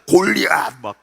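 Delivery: exaggerated, heaved-out name; hulking giant. Pastor showing how huge the giant's head is, hammed-up bigness. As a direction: blow the word up to giant size.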